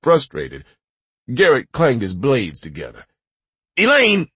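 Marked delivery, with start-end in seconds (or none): 3.8-4.3 loud, high shout; shouting her name. Acted.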